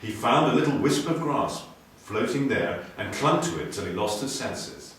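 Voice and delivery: ordinary delivery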